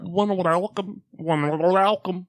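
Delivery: slow loud mocking welcome; mock garbled intro. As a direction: parody his mangled intro, loud.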